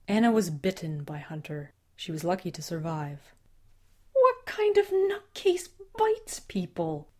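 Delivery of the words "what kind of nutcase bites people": angry disbelief. Acted. Father scoffs in disbelief.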